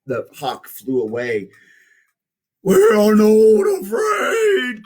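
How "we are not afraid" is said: very high, loud and slow; bold cry. Acted. Frog and Toad cry out together after the hawk, slow and loud. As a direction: stretch out a defiant shout. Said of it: "cried Frog and Toad at the same time".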